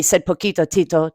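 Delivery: usual speaking voice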